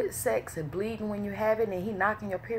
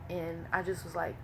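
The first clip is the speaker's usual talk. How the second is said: ordinary talk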